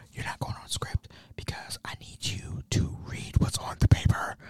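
hushed courtroom correction; whispering. Retold or imitated. Son tells mom she's off script, whispering. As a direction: whisper a firm reminder under your breath. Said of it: "So I'm, I'm just whispering".

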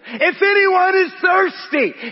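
high, drawn-out cry to the crowd; shouting. Acted. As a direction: cry it out slowly as Jesus shouting in the temple. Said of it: "was shouting in the temple".

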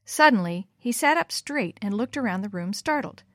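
ordinary delivery

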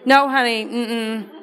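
drawn-out mm-mm with a laugh, no honey; teasing no, honey; sassy refusal. Pretend. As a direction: shake your head at them, playful.

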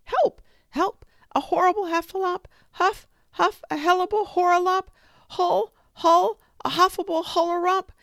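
very high, loud, fast scared squeals, words jumbling; panicked squealing. A narrator's reading. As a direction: shriek in terror while running away. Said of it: "still crying out".

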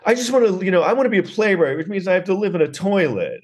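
high mock-artist whine; whining would-be playwright. Mocking the son who wants to be an artist, high whine. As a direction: plead in a high, self-pitying mock voice. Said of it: "I'm like".

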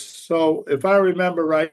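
normal talking voice

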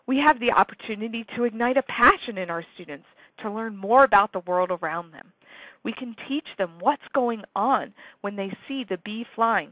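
normal talking voice

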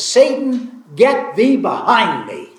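high, loud rebuke, sharply commanding; stern rebuke. Retold or imitated. Jesus rebuking his friend Peter: high, loud stern command.

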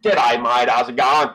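broad Australian G'day mate; Aussie greeting. Retold or imitated. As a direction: drop into a loud Aussie bloke greeting. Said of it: "he says".

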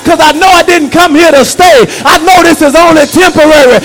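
preaching. Acted. Preacher rousing the church, full-force preaching. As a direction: preach it hard to the congregation.

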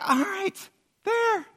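high-pitched, exasperated surrender; hammed-up giving in; mock giving in. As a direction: squeak out a reluctant concession.

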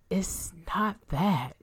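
slow, breathless words; out of breath. A narrator's reading. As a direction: speak while catching breath. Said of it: "trying to catch her breath".

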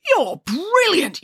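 a delighted hammed-up shout; yelled. Chelly praises Ant in a stretched, high mock yell. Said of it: "yelled Chelly".

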